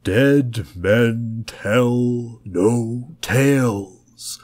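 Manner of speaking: very slow, drawn-out ghost voice; spooky voice. A narrator's reading. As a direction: stretch every word like a campfire ghost. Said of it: "in his best spooky voice".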